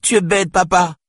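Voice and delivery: put-on very high, loud little-girl protest; crying. A narrator's reading. Daughter lashing out at her father, crying. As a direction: wail it at the parent. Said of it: "pleura-t-elle".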